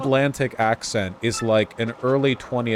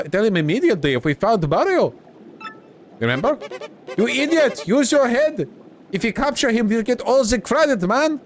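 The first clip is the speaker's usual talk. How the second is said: high, swooping cartoon minion voices; bickering henchmen; 12 semitones higher than usual. Henchmen arguing over who gets credit for Mario, high bickering voice. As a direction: squabble as silly high-voiced villain lackeys.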